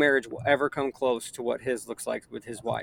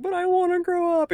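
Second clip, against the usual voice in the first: put-on much higher wailing child's voice; upset little girl; 5 dB louder than usual. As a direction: mimic a four-year-old protesting through tears. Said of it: "And she lost it".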